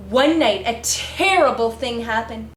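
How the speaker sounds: ordinary delivery, nothing marked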